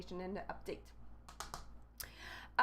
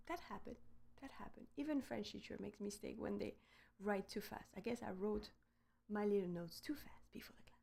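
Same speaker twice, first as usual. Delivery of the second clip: much softer, almost under the breath; quiet aside; 9 dB quieter than usual. Real, not acted. French teacher admits a mistake in the notes, quiet aside.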